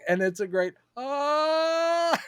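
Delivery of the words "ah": high drawn-out mock "ah"; mock pained cry. Imitating Zod as his hand gets crushed, mock pained cry. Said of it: "you hear the bones crunching".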